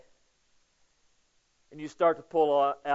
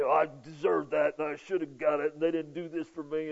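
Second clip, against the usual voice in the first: self-pity. Acted. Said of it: "We just have a pity party".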